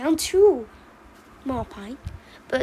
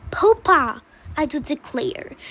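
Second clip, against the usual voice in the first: exasperated; 4 dB louder than usual. Acted. Mama Pine scolds Papa for exaggerating, exasperated. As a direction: call out your husband's name in exasperation.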